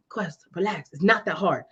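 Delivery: imitating a calm, unbothered mentor; reassuring. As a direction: quietly talk down a panicker. Mentor calms her panic.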